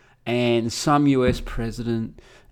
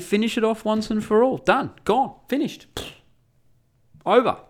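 high-pitched scornful harangue; mocking tirade; 9 semitones higher than usual. Haranguing a lefty Israeli at lunch, high mocking rant. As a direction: lecture him mockingly, pitched well up. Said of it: "I just started mocking him openly".

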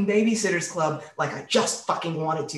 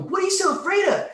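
mocking taunt; 12 semitones higher, 4 dB louder, faster than usual. Instructor taunting scared teen.

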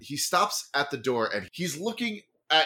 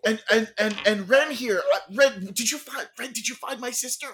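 eager brother; 7 semitones higher than usual. Acted.

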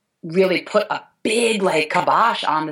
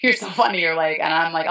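ordinary talk, nothing marked

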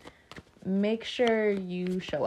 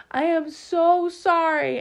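loud, high, drawn-out mock pleading; hammed-up apology; 9 semitones higher, 8 dB louder than usual. Aquarian to friends after three months, hammed-up apology. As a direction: overdo the apology, stretched and high.

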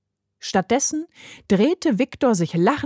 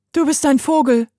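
put-on higher, blunt child's voice; 6 semitones higher, 4 dB louder than usual. A narrator's reading. Heidi tells the stranger what he is, bluntly. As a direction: say it straight out as the girl. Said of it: "brachte es Heidi ohne Umschweife auf den Punkt".

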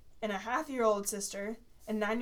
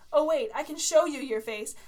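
mocking; 5 semitones higher, 7 dB louder than usual. Mocking friend's face.

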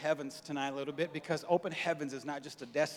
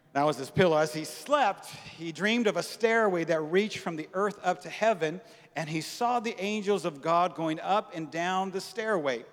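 louder, driving preaching; 2 semitones higher, 5 dB louder than usual. Acted. Pastor preaching God's promise to Jacob, emphatic. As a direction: preach it out to the room. Preaching scripture at full force.

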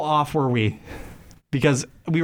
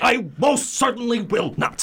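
indignant Ernest; 7 semitones higher than usual. Acted. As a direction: shout the prim protest. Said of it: "read for Ernest".

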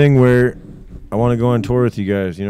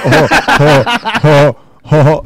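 loud, higher cartoon chuckle; Butthead; 5 semitones higher, 9 dB louder than usual. Complaining about too much Butthead, mimics the cartoon laugh loudly. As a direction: imitate the cartoon character's repeated dopey laugh. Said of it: "is just too much butthead".

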